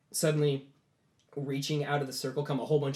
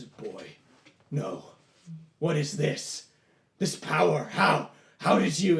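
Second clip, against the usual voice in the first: booming demon voice; 4 dB louder, slower than usual. Acted. Demon shocked by the boy's power, booming voice. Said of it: "you hear their voice boom out".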